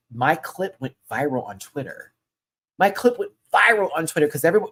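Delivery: usual speaking voice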